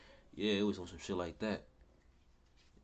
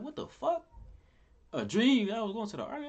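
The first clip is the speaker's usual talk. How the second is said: high-pitched, loud disbelief; incredulous reaction; 14 semitones higher, 6 dB louder than usual. Retold or imitated. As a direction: act out baffled disbelief at a strange text, pitched way up. Acted reaction to odd text.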